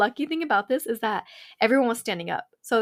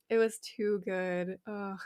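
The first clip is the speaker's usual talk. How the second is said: ordinary delivery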